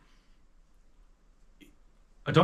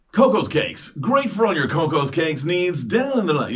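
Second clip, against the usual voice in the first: higher, louder, slow ad read; hammed-up cupcake ad voice; mock voiceover ad; 8 semitones higher, 7 dB louder than usual. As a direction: sell it like a radio commercial announcer. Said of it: "You'd be like".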